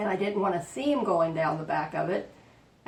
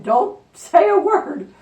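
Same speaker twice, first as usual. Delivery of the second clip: high, loud, drawn-out order; playful retold warning; 10 semitones higher, 13 dB louder, slower than usual. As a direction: call it out slowly in mock command. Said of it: "I'm telling him".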